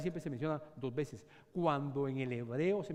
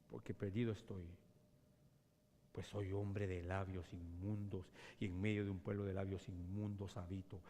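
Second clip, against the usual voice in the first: hushed confession; 7 semitones lower, 6 dB quieter than usual. Acted.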